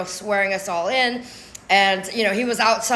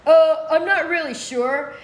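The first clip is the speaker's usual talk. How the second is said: higher, dopey imitation; mock clueless man; 5 semitones higher than usual. Father unsure about his visitation order, mock clueless. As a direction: mimic someone with no idea, dragging the "uh". Mocking Thomas's clueless answer.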